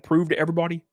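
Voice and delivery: normal talking voice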